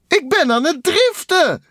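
very high, loud squeal; exhilarated shout. A narrator's reading. Takel drifting over oil, high exhilarated cry. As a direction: whoop it in a high cartoon voice. Takel whoops while sliding.

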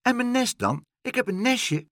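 piping up for its nest; little bird. Acted. A small bird pleads with Pluk for its nest, high and anxious. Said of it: "riep een klein vogeltje".

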